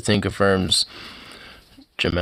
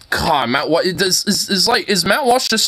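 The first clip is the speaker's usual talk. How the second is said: exasperated; 8 semitones higher than usual. Real, not acted. Exasperated outburst at Walsh.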